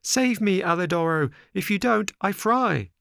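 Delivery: high, loud, desperate cry for help; pitiful little voice. A narrator's reading. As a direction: scream for rescue from the pan. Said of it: "a pitiful little voice was heard saying".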